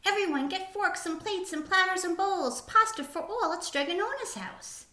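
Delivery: high, calling-out announcement to the crowd; excited shout from the fountain; shouting to the town. A narrator's reading. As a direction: call the whole square to come eat. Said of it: "He jumped on the fountain and shouted".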